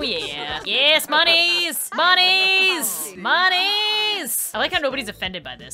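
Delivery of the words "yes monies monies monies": slow, stretched gleeful cheer; sing-song monies. Real, not acted. Streamer cheering monies, slow and gleeful. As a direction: drag out each monies.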